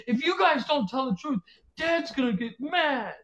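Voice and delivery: wife playing along, threatening kids; mock stern mom. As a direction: warn the kids in a put-on voice. Wife warning kids, acted.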